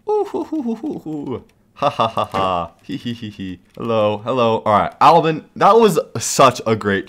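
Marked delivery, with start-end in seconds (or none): 0.1-3.6 hooting laugh fading to a quiet snicker; gleeful cackle. Real, not acted. Cackling after the prank.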